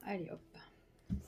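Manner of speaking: very soft, slow, whispered to oneself; whispered aside. Real, not acted. Card reader to self while picking a card, whispered. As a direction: whisper it slowly under the breath.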